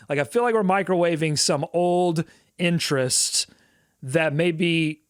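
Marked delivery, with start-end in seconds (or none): none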